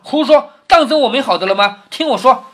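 indignant retort. Acted. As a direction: insist loudly on having your turn. 薛蟠不服，打断云儿. (不服气地高声抢话)

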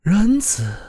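a voice ringing out from heaven; radiant heavenly choir voice. A narrator's reading. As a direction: call it like heaven itself answering. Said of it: "无尽的太空中响起了这样的声音".

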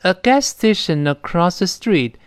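A gas station across the street, read slowly with station linking into across; A gas station across the street, with n running into a; A gas station across the street, a slow linking drill; linking demo. Shown as a demonstration. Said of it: "把它连读".

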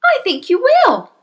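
high, emphatic reply; mother in the story. Acted. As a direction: tell your husband firmly, high-pitched. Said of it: "the mother said".

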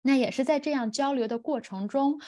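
ordinary talk, nothing marked

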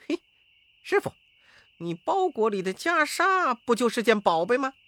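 high, loud monkey-disciple voice; high-pitched, excitable monkey character; Wukong. Acted. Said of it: "悟空就在旁边说".

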